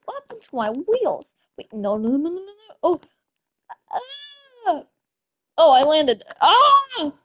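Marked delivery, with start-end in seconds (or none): 3.9-4.8 quick high yelp; startled cry. Real, not acted. Crashing in the game. 6.4-6.7 sudden loud high yell. Real, not acted. Hitting the ground.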